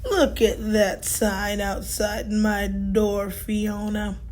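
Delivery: low, slow and unhurried; Tortoise answering Fiona slowly; slow old tortoise. Acted. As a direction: take your time like an ancient tortoise.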